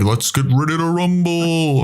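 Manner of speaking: slow, booming ring announcer call; boxing announcer. Retold or imitated. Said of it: "the radio announcer for, like, all the boxing matches".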